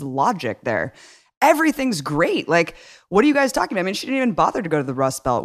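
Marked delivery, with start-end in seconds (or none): 1.4-3.8 high, fast, breezy mock voice; mock clueless Hillary. Mocking Hillary's oblivious logic in a high, fast voice. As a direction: toss it off high and fast like it's obvious.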